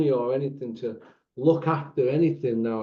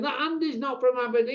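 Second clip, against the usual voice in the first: imitating people, pitched way up; mock high-pitched teasers; 9 semitones higher than usual. People at him about Andy, in a high mock voice. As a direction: parrot the gossip in a squeaky mock voice. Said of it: "they keep going".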